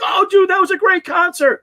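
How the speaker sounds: high excited dude shout; mock shouted party-guy voice; hammed-up rocker, loud and high; quoting a guy. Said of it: "He's like".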